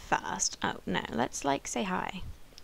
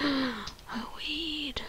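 shocked whisper; 5 semitones higher, 7 dB quieter than usual. Real, not acted. Spotting a weed in game.

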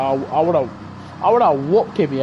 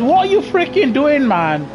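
ordinary talk

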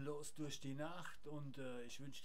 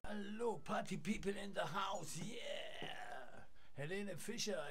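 frat bro; 4 semitones higher than usual. Acted. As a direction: pump up the crowd like a frat bro. Hype party call to Helene.

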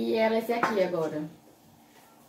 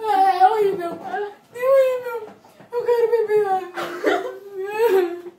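choramingando; 6 semitones higher, slower than usual. Real, not acted. Gosto horrível, choro e riso.

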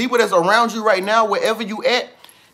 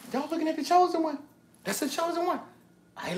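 hushed crowd gossip; 9 semitones higher, 9 dB quieter, faster than usual. Acted. Crowd voiced, whispering again.

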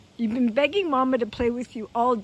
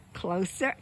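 usual speaking voice

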